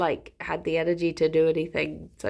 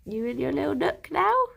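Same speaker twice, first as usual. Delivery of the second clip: much higher, cooing voice for the pet; pet talk; 8 semitones higher than usual. Real, not acted. Owner fusses over her pet Jerry, high cooing. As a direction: sweet-talk the animal.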